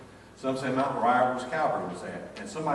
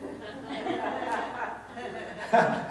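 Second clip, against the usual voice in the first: high delighted laugh; 10 semitones higher than usual. Real, not acted. Preacher laughing at coach story, high laugh.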